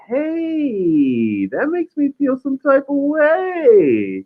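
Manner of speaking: mocking the ex. Mocking the ex's Instagram message, high and whiny. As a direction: whine it out like a mocking impression of the ex. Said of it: "She's like".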